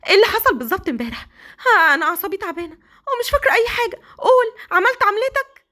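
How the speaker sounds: high, fast, shrill accusing shout; shouting accusation. Acted. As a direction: yell at him in shocked outrage. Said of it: "فضلت تصوت وتقول". (سيليا بتزعق فيه بصوت عالي وسريع)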